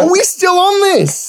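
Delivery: very high, drawn-out squeal of disbelief; mock exasperation. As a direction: protest in a comic high wail. Mock outrage at an old joke.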